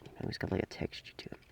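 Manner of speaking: hushed whisper about the texture; a quiet, whispered aside. Real, not acted. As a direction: lean in and whisper it. Describing the toy's texture.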